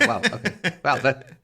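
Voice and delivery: amazed. Retold or imitated. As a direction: marvel at it like a revelation. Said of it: "I'm like".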